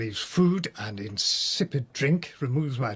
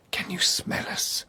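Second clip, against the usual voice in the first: whispered; 5 semitones higher than usual. Acted. Taunting lieutenant in cell.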